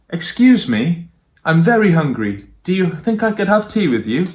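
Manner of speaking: a lightly voiced hungry tiger begging nicely; polite tiger. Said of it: "The tiger said".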